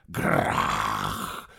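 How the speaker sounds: low, drawn-out monster growl. A narrator's reading. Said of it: "Болотная тварь издала своё".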